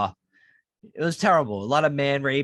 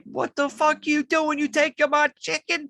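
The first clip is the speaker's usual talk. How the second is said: woman on the train; 12 semitones higher than usual. Retold or imitated. Retelling the train woman's outrage at the hobo, high-pitched mock voice. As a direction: squeal it like the startled commuter. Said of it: "She's like".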